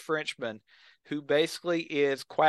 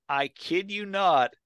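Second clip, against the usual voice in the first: ordinary delivery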